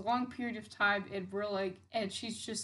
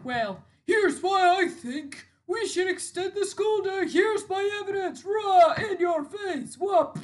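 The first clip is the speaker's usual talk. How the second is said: much higher, louder, slow, gloating; cocky debater; 9 semitones higher, 11 dB louder, slower than usual. Retold or imitated. Arguing in seminar, high, loud and cocky. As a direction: rub the evidence in their faces.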